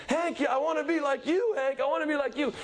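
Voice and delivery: high-pitched, gushing, fan's cries; adoring fan screaming. Retold or imitated. Fan screaming his love at Hank, high and frantic. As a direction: gush like a starstruck fan. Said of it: "He's just going crazy".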